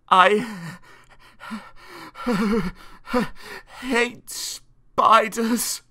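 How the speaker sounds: put-on high, halting boy's stammer; acted terror, very slow and broken; frightened stammer. A narrator's reading. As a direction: stammer it out in fear with breathy catches. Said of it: "stammered Tristram".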